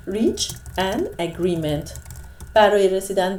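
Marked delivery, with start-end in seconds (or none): 0.1-1.9 very slow, deliberate phrase; slow collocation drill. Shown as a demonstration. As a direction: hold each word for the class.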